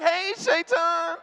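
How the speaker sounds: high, drawn-out sing-song hello; friendly mock greeting. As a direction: greet a tempter like an old pal.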